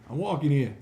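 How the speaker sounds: put-on loud, laughing New York accent; a laughing, hammed-up "I'm walking here" from New York. GM voicing Gilliam with a New York accent. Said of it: "He's from Jersey".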